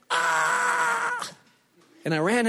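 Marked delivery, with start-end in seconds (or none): 0.1-1.3 high, strained imitated scream of the wife; mock screech. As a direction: let out her sudden screech, much higher. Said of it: "this horrifying screech".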